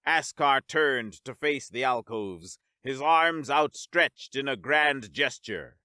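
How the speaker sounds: ordinary delivery, nothing marked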